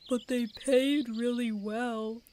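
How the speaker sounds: slow, mocking put-on voice for the greedy traitor; mock the greedy traitors with a slow silly voice; mock traitor excuse. Streamer mocking greedy traitors, slow put-on voice. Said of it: "all these traitors are like".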